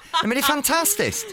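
laughing high through praise; host laughing at the guest's song. Real, not acted. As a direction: giggle while saying it's fantastic. Host laughs after singing.

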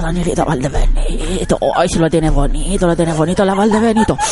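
put-on very old man. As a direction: croon it like a dirty old man. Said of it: "con voz de viejo degenerado". (voz de viejo verde, grave y lenta)

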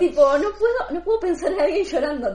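high-pitched laughing admission. Real, not acted. Admitting to friends she panics, laughing high.

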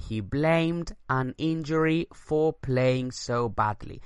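slow, even English repeat; slow dictation read. Shown as a demonstration. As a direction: say it again slowly for the class. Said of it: "Repito la frase".

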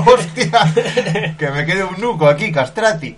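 high, loud, laughing through the words; giggling mock squeal about the freezing river; laughing mock yelp. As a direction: retell the cold shock while cracking up. Retelling icy river shock.

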